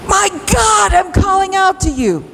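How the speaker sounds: pleading, urgent shout; urgent cry. Shown as a demonstration. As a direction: cry out to God with everything you have. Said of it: "Hear the difference in urgency?".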